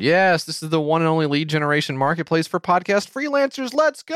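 high excited pitch-man; hype announcer. Pretend. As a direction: sell it like an ad. Hyping the product.

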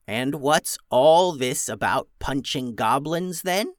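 put-on a sly old goblin questioning. Old goblin leader speaks.

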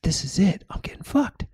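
soft, rising nervous whisper; inner thought. Retold or imitated. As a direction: think it aloud, hushed and tense. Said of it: "I'm thinking".

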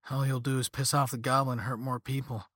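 ordinary delivery

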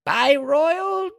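high, slow herald voice; town crier. Acted. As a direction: proclaim it like a crier.

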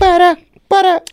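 butter, butter in a falsetto squeal; silly squeaky voice. Pretend. Co-host squealing a silly falsetto joke. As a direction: squeak it out high like a cartoon.

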